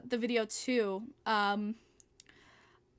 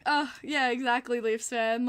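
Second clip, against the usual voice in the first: normal talking voice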